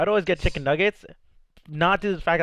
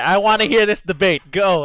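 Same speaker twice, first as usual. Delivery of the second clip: excited; 5 semitones higher, 12 dB louder than usual. Real, not acted. Egging on an eggplant debate.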